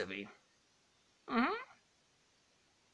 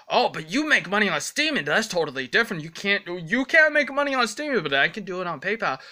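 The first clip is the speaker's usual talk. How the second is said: higher, fast mocking critic voice; whiny critic; 5 semitones higher, faster than usual. Vlogger imitating a critic about streaming money, higher and fast. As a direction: rattle off the critic's argument in a mock voice.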